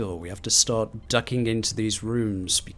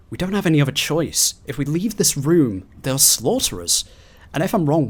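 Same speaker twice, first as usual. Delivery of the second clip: panicked log reading; 3 semitones higher than usual. Acted. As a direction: read it like someone hiding from monsters. Reads panicked final log.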